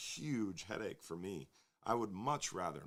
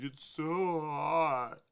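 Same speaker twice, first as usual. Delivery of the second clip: mock whine, much higher, louder and very slow; mock complaining Floridian; 7 semitones higher, 8 dB louder, much slower than usual. Host mocking Floridians who moan about June heat, whiny mock complaint. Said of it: "everybody's like".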